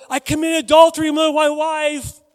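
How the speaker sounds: high, very loud admission; yelled confession. Pretend. Man walking around town confessing to all, yelling. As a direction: holler it across town. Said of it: "That's what I yelled".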